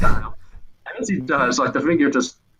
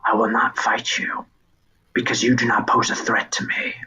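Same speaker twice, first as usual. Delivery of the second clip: whispered herald. Acted. Herald whispering dismissal to the boy. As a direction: breathe it out, unbothered.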